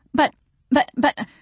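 high, flustered stammer. A narrator's reading. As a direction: stumble over the word in protest. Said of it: "Jenny stammered".

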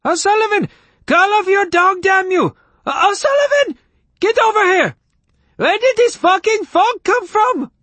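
extremely high, loud panicked yelling; frantic angry shrieking at the top of the range. A narrator's reading. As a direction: scream for help in a sky-high falsetto. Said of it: "panicked voice immediately leapt to Mariah Carey".

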